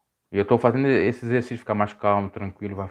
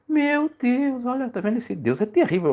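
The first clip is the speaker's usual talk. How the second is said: higher scoffing skeptic voice; lightly voiced sneer of an unbeliever; mocking atheist; 13 semitones higher than usual. Preacher imitating an atheist mocking the text, higher scoffing tone. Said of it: "Imagina o ateu analisando esse texto".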